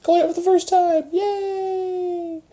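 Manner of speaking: very high squeaky cheer. Pretend. Host cheering a first-timer on, squeaky high.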